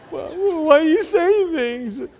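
put-on very high little boy voice; bewildered boy. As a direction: ask like a baffled kid. Boy asks grandma.

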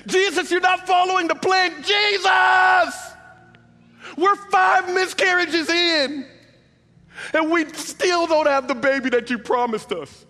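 high, loud, accusing cries; angry shout. Pretend. Hurt believers shout their unmet plans at Jesus, angry shout. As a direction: yell the broken promises up at Jesus. Congregation's complaints voiced at Jesus.

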